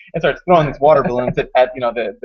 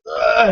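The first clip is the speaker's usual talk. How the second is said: higher-pitched dying grunt; mock collapse from a zombie bite; imitated death groan; 8 semitones higher than usual. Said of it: "he finally died to the bite".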